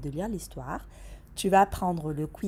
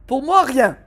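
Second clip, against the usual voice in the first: disgusted; 6 semitones higher, 14 dB louder than usual. Acted. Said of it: "avec une mine dégoûtée".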